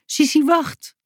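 high, urgent call after someone. A narrator's reading. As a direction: call her back, pitched high. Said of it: "riep hij".

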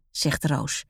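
usual speaking voice

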